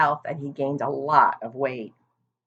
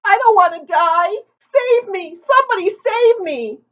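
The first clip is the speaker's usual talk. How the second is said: much higher, louder cries for help; panicked pleading; 10 dB louder than usual. A narrator's reading. Terrified pig crying for rescue, much higher and louder. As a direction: beg anyone to save you. Wilbur panics for his life.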